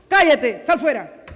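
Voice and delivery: loud commanding bark at the demon; shouted rebuke. Shown as a demonstration. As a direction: shout it out like an exorcist. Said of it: "Ahora la gente grita".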